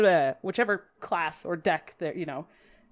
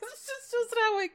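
much higher, squeaky goofy voice; silly squeaky voice; 3 dB quieter than usual. Pretend. Host being goofy after apologizing, much higher squeaky voice. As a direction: squeak it out like a cartoon. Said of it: "I'm cringe and corny".

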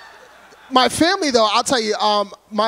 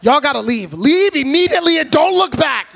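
loud retold order to flee; mock-panicked evacuation order; urgent warning; 5 semitones higher, 6 dB louder than usual. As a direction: send friends packing, louder and higher as it goes. Retold urgent warning to his friends.

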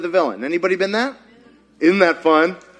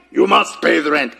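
mock villain demanding rent; hammed-up melodrama villain; sneering panto villain; 2 semitones lower than usual. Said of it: "This is supposed to be a mustache".

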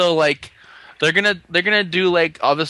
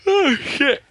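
high, yawn-stretched curse; 11 semitones higher than usual. Real, not acted. Podcaster caught by a yawn mid-recap, yawning. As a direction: swear through a yawn. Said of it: "I don't know why I keep yawning".

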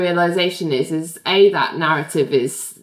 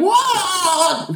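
startled what; 9 semitones higher than usual. Retold or imitated. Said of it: "Ally wheels around going".

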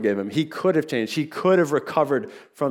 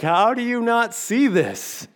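much higher, slow incredulous cry; exasperated disbelief; 11 semitones higher, 4 dB louder, slower than usual. Retold or imitated. Moviegoer yelling at the screen about the hidden killer, high exasperated disbelief. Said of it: "you're like".

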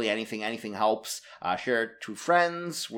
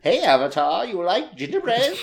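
high, teasing witch voice; hammed-up witch tempting a child; louder, pitched-up pantomime witch with a cackle ready; fairy-tale witch; 7 semitones higher, 3 dB louder than usual. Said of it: "Is this the witch from Hansel and Gretel?".

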